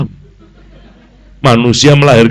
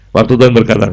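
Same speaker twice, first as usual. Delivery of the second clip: normal talking voice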